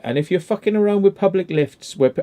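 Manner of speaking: ordinary delivery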